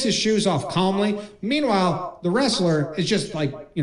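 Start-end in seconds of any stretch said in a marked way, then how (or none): none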